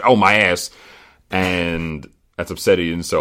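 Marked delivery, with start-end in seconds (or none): 0.1-0.7 a loud mock cry of pain; mock yell. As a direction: yelp it like a hurt snowboarder. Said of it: "pretty much".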